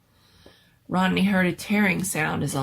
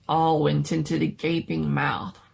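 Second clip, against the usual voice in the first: plain reading voice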